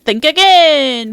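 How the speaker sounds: slow booming shout; drawn-out, booming declaration; loud announcer shout. Acted. Game voice line quoted loudly.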